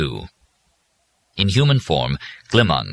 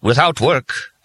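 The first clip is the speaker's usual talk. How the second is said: firm, raised opening; more forceful; 7 semitones higher, slower than usual. A narrator's reading. Puritan preacher booth lecturing Joe, forceful. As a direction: press the words with Puritan force. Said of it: "in a somewhat more forceful voice".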